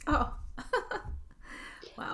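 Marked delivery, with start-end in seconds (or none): 0.4-1.2 a high, airy laugh with no voice; breathy laugh. Real, not acted. Host laughs at the story.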